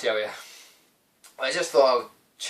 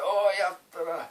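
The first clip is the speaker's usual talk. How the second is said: high-pitched mocking imitation of an angry man; mock fuming; 7 semitones higher than usual. Reseller complaining after losing the games, mock fuming. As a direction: mimic his fuming grumble, pitched up. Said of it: "he was fucking fuming".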